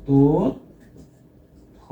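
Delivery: তথ্য drawn out as "toot... tho"; syllable-by-syllable pronunciation. Shown as a demonstration. Teacher dictates the spelling by sound, তথ্য slowly as "tot... tho". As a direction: stretch the closed o of তথ্য, pause, then "tho". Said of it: "তাহলে এর উচ্চারণ হবে".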